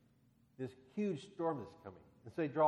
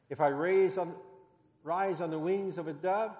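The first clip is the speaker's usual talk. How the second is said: scripture reading; 3 semitones higher, 6 dB louder than usual. Acted. Pastor reads Psalm aloud.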